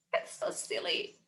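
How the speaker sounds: unvoiced soft whisper. Real, not acted. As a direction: breathe it out. Breathless after gibberish.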